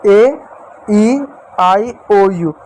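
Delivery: each vowel drawn out slowly; slow recitation. Shown as a demonstration. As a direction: count off the vowels slowly, like a teacher. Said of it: "कौन कौन सी?".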